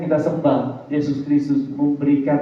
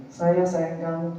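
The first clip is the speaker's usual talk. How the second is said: mock sweet talk; 2 semitones higher, 6 dB quieter, slower than usual. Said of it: "empty words with no action".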